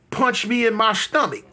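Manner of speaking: ordinary talk, nothing marked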